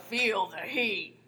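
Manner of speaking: very slow, stretched-out put-on voice; mock game catchphrase. Co-host recalling another repeated game line, mock catchphrase voice. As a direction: draw out every word like a cartoon battle cry. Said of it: "what's the other one?".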